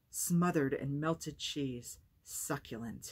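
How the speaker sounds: slow, relishing each word; boy teases grandmother, slowly savoring the pizza; savoring satisfaction. Acted. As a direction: linger over it like tasting the cheese. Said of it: "he said again with satisfaction".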